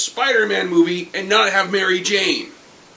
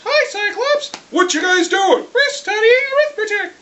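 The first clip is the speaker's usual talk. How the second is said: put-on much higher, squeaky children's chorus; mock kids' voices; 3 dB louder than usual. Kids answering Cyclops, squeaky mock children. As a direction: chirp like little schoolkids.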